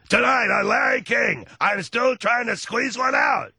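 loud, high announcer intro; announcer shout. Retold or imitated. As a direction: boom out the show intro. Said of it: "when he introduces his show".

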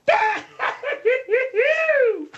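high, shouted burst of laughter. Real, not acted. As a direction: burst out laughing at the top of your range. Host laughs at caller's quip.

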